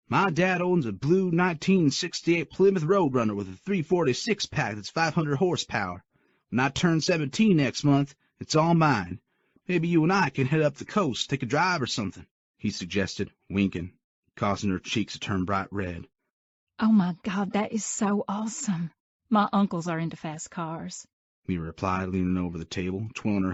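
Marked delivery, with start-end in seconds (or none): 0.1-12.3 put-on higher, louder boastful kid's voice; bragging teen boy. A narrator's reading. The date bragging to Mia about his dad's car, higher and boastful. 16.8-21.1 put-on gushing young woman. A narrator's reading. Mia gushing over her date's car, high and excited.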